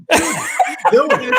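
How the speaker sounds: high incredulous cry; shocked. Retold or imitated. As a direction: cry it out in disbelief, half laughing. Finds friend peeing on car.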